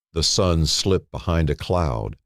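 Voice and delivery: plain reading voice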